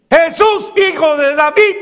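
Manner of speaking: high, loud cry for help; desperate shout. Acted. Blind man shouting to Jesus over the crowd. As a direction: call out across the crowd. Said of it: "comenzó a gritar".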